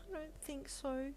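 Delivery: high, soft, slow sigh. A narrator's reading. Wife sighing to husband about rumours, soft high sigh. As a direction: sigh it out wearily. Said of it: "you sighed".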